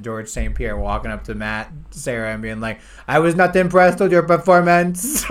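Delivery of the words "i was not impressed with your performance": a light French-accented imitation, a bit higher and louder; mock GSP's polite French-accented trash talk. Friend imitating GSP's French-accented trash talk to Serra. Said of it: "in his French accent".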